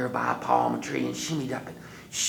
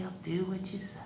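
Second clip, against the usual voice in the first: soft unvoiced whisper; whispered repeat; 9 semitones higher, 7 dB quieter than usual. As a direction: say it under your breath.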